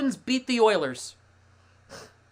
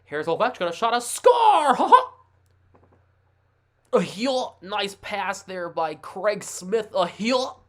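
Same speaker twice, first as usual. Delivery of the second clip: mock play-by-play; 2 semitones higher, 4 dB louder, slower than usual. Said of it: "the play-by-play".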